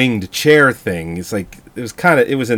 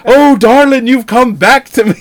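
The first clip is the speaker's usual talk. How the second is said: high, loud hammed-up swoon; mock swooning husband; 10 semitones higher, 7 dB louder than usual. Husband greeting his wife after the execution, high hammed-up swoon. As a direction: camp it up like a melodramatic lover.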